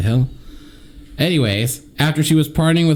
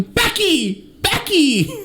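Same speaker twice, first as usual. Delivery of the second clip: mock shout; much slower than usual. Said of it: "I was shouting".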